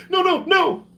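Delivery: high pleading no, no, no; mock panic. Mocking a man begging before being shot, in hammed-up panic. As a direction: beg for your life, hammed-up.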